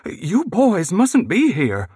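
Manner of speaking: high, slow fretful lady's voice; a nervous hostess shooing boys away, high and slow; flustered lady. A narrator's reading.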